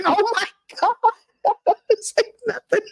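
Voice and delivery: high squeal through laughter; laughing. Real, not acted. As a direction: barely speak for laughing. Laughing through retold phone call.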